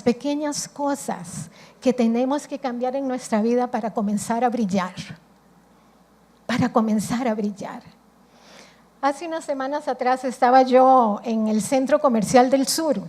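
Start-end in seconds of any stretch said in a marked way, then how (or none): none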